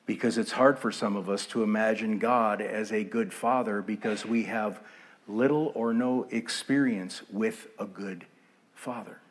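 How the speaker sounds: normal talking voice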